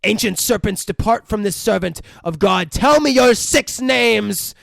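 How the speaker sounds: loud, high commanding exorcist, slowing on the last words. Acted. Priest demanding the demon's six names, commanding.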